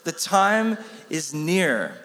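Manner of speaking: slow, deliberate The time is near; slow unison recital. Shown as a demonstration. Preacher has the room answer his question slowly. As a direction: draw out each word as the answer.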